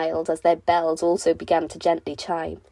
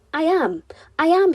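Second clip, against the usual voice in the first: breathless whisper rising to a high, loud cry; excited; 9 semitones higher, 5 dB louder than usual. Acted. Half Pint telling his friends he feels better, excited rising cry. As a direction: burst out happily, louder the second time. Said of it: "flapped his arms excitedly".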